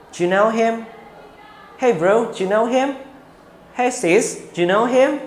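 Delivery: "Do you" run together as one quick "dyu", the question ending high; reduced do you. Shown as a demonstration. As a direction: blend "Do you" into "dyu" casually. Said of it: "kalian singkat menjadi do". ("Do you" dilebur jadi "dyu" yang cepat, nada naik di akhir)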